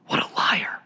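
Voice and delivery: whispered aghast aside. Real, not acted. Preacher repeating his verdict on Satan, whispered. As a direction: drop to a voiceless whisper of disbelief.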